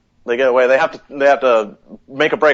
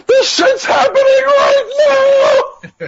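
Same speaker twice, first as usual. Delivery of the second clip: far higher, slow, frantic cry; mock panic shout; 5 dB louder, much slower than usual. Mocking the usual outbreak trope, hammed-up frantic shout. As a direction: ham up the panic like a stock zombie movie.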